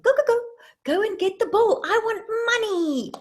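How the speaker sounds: high, loud cheering-on of a puppy; excited puppy command. Acted. Owner sending puppy dog after money, excited command. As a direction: send the dog off with high-pitched urgency. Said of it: "imagine we say to our puppy dog".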